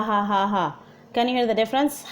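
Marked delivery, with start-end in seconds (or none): none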